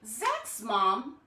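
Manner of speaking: ordinary delivery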